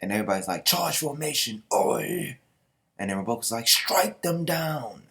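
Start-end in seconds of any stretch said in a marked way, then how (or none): 0.6-2.4 high command ending in a loud war cry; battle shout. Acted. Everybody shouts battle orders. 3.7-5.0 high, loud, very slow order; commanding battle cry. Acted. Leader orders the attack.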